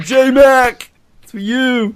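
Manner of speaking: high, called-out shout to a fan; mock victory dedication. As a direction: holler it like a triumphant athlete. Playing the athlete's victory dedication.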